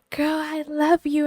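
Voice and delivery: high, sweet mock of the coworker; imitating coworker. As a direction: coo it like a cheerful coworker. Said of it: "she goes".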